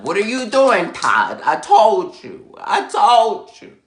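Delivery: loud, high retold scolding; fuming. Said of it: "fuming because I'm like".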